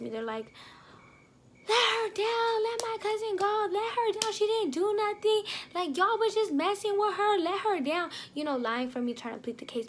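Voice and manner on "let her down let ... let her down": high, loud pleading from the cousins. Retold or imitated. Cousins begging the staff to let her go, high and loud. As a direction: beg them to put her cousin down.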